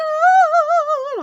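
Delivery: high, loud retold shriek of shock; startled scream. Caught by the cleaner, loud high shriek. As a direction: shriek as if caught bent over. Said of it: "like, screamed".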